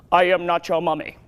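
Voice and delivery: low put-on character voice; silly impression. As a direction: drop low for a goofy impression. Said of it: "walking around wrapped in toilet paper going".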